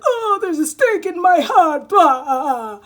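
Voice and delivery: high, loud hammed-up dying wail; melodramatic death cry. Mocking the client, she fakes a loud vampire death cry. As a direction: ham up a vampire staked through the heart. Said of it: "that feels so dramatic".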